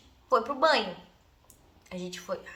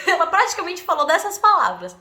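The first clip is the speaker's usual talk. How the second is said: ordinary talk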